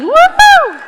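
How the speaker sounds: high, loud celebratory woohoo; excited whoop. Real, not acted. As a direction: cheer it out with full joy. Auctioneer cheers after bids.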